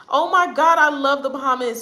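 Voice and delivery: high, loud burst of delight; excited gushing. Real, not acted. Vlogger reacting to Bahamas suggestion, thrilled. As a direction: gush with real enthusiasm. Gushing about a favorite place.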